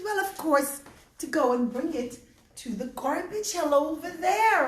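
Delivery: put-on high, cracking old lady voice; old woman voice. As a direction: speak like a frail grateful old woman.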